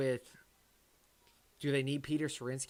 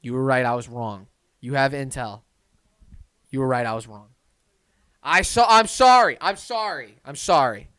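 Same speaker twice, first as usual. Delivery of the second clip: loud apology; 14 dB louder than usual. Real, not acted. Loudly apologizing to the chatter.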